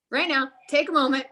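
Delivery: ordinary talk, nothing marked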